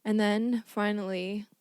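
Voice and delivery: lightly hyped, slow matchup announcement; show announcer. Acted. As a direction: present the battles like a lightly hammed-up fight announcer.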